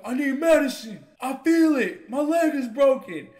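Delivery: high, loud mock wailing; demanding patient. Injured roommate giving the nurse a hard time, imitated wail. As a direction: imitate a hurt man hollering at staff. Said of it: "he was giving her a hard time".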